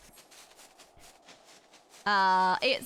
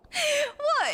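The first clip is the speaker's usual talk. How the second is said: high, incredulous what; real disbelief, much higher; shocked what; 14 semitones higher than usual. Real, not acted. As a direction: yelp it in disbelief. Shocked at condensed milk comment.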